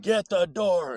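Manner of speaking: high, loud impatient command; sharp repeated order. Retold or imitated. Mom repeats order angrily.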